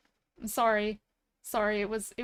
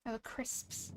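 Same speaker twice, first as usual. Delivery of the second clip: softer breathy "Oh, the crisps"; hushed mock British; 13 dB quieter, slower than usual. Shown as a demonstration. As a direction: murmur "the crisps" like a secret. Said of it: "they're crisps".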